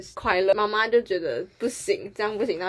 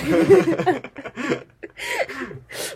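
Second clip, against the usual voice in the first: long falling laugh; 7 semitones higher, 4 dB louder than usual. Real, not acted. Laughing about mom's crash.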